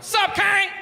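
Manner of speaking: high, loud shouted greeting; loud mock aggressive shout at a celebrity; aggressive holler. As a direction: bellow it at him from across the boulevard.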